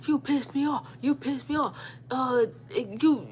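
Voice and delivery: high-pitched mock tearful complaint; retold crying yell. She repeats her tearful complaint, high mock upset. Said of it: "she started crying while she's yelling".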